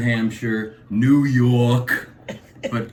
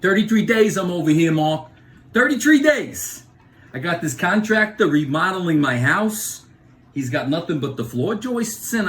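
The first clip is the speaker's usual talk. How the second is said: put-on Bronx accent; 5 semitones higher than usual. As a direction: blow up at the lazy contractor. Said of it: "people from the Bronx, like my friend, uh, Jack".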